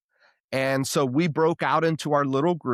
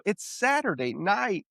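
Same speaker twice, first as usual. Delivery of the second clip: higher, drawn-out objection; incredulous pushback, pitched up; retold protest; 8 semitones higher, slower than usual. Retold protest at short notice.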